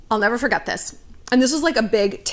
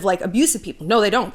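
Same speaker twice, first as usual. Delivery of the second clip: usual speaking voice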